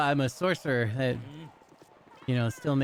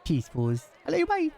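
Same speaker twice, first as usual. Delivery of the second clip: usual speaking voice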